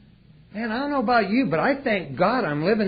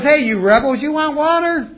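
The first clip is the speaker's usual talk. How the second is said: higher, louder taunting yell; angry yell; 10 semitones higher, 8 dB louder than usual. Retold or imitated. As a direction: shout it at the grumbling crowd. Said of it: "He goes".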